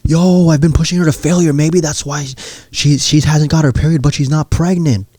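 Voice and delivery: hammed-up bro revelation; amazed realization. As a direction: exclaim it like everything just clicked. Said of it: "his eyes open up like".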